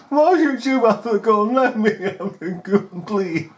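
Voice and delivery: high pleading through laughter; laughing plea. Pretend. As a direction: beg for the gun while laughing. Bystander begging for gun, laughing.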